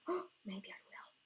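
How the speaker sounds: gasp, then a tempted whisper; hushed, tempted aside to herself; excited whisper. Real, not acted. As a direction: whisper it like a guilty idea. Tempted to add spots.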